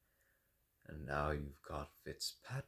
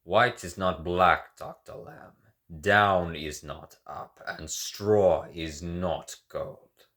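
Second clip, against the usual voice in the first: loud, slow, measured axioms; forceful declaration; 10 dB louder than usual. Acted. Ryan lecturing Doctor Lamb, slow forceful declaration. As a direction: hammer each contrast.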